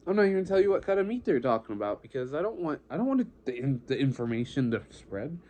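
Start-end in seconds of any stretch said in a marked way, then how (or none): 0.1-3.3 fast rant. Real, not acted. Ranting, rushed.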